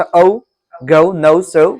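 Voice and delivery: औ, गौ, नौ, सौ with a clear rounded "au" vowel; pronunciation teacher giving examples; sound demo. Shown as a demonstration. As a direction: show the "au" sound in औ, गौ, नौ. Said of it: "pronounce करने के लिए बताया था".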